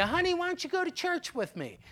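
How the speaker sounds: soft, quick, coaxing wife voice; sweet-talking wife. Retold or imitated. Wife coaxing husband to church, soft sweet-talk. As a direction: coax him gently like a sweet wife. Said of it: "They start sweet-talking you".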